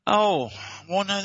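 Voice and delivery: ordinary talk, nothing marked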